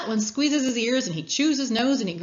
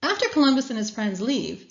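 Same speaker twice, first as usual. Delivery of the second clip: ordinary delivery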